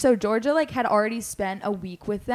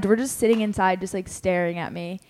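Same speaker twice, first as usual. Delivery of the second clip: normal talking voice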